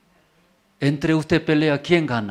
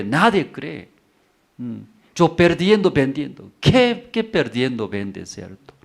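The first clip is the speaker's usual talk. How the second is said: usual speaking voice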